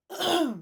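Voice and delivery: sudden loud high grunt; loud startled grunt. Real, not acted. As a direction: blurt a startled uh.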